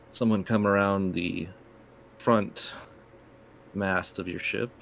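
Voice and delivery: ordinary delivery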